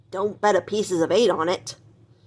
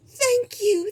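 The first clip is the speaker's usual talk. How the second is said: much higher gushing thanks; diva queen. Acted. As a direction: accept the adoration like a diva. Queen greets bowing boars.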